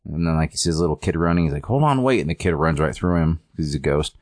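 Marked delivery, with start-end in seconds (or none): 1.7-2.2 calling out. Retold or imitated. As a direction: call after the running kid. Terry calling after a running kid.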